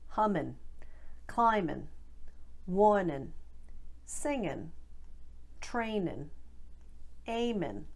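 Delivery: Boston "-in'" endings, g dropped; Boston accent. Shown as a demonstration. Coach drills South Boston dropped-g endings. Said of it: "I N G endings get shortened to I N".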